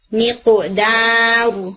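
میقو دارو said slowly with a relaxed jaw on the ق; slow میقو دارو with a relaxed ق bounce; correct qalqala. Shown as a demonstration. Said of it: "اس کو آپ نے relax رکھنا ہے".

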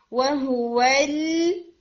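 وَهُوَ ال slow, ending on a held l; full-contact lam. Shown as a demonstration. Teacher correcting students, وَهُوَ ال with a long lam. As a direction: hold the lam of وَهُوَ ال on the whole area. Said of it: "پورے، آ، area کو ہٹ کریں".

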